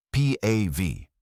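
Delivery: plain reading voice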